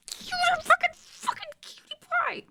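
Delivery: very high squeal of adoration. Real, not acted. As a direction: squeak it out at the cute dog. Gushing over her dog.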